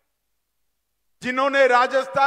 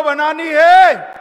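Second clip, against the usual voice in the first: slow shouted reply; loud call; 3 semitones higher, 8 dB louder, slower than usual. Real, not acted. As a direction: shout it so the crowd joins. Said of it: "जोर से बोलो".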